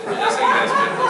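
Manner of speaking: laughs through the words; chuckling at his own lost sleep; laughing. Real, not acted. Preacher to congregation about his sleepless night, laughing.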